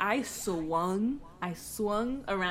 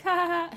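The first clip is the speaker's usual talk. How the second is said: mocking mom laugh; 10 semitones higher, 7 dB louder, much faster than usual. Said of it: "she's just like".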